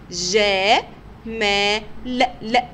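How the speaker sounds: جا، ما، لا as separate syllables, long "jaa, maa", short "la"; teacher isolating جا، ما، لا with gaps; sounded out. Shown as a demonstration. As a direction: break the word up, repeating the short last sound. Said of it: "يا ترى سمعنا صوت اللام".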